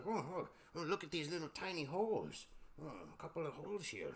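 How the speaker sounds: hushed pirate chatter, under the breath; pirates muttering. Acted. Pirates murmuring over the holes, hushed.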